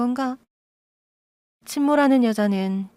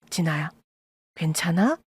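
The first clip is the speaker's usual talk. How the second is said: gentle; 4 semitones lower, 3 dB quieter than usual. A narrator's reading. Comforting crying daughter.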